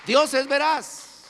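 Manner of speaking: high, loud, slow declaration; emphatic preaching. Real, not acted. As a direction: proclaim it slowly, word by word. (proclamación lenta y enfática)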